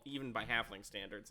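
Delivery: ordinary talk, nothing marked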